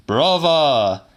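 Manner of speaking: put-on a high, loud old man's cry; old man's cry of brava. A narrator's reading. Mister Kelly calls out to Celia, loud and high. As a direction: call it out like a delighted old uncle. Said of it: "said Mister Kelly".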